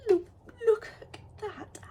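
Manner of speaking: high, slow squeal; squealing cute voice. Real, not acted. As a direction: coo at something adorable. Gushing over bumblebee.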